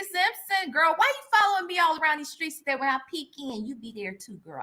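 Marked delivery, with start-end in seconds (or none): none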